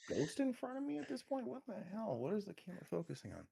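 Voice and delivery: sudden high startled voice; startled outburst. Real, not acted. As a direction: blurt it out, spooked.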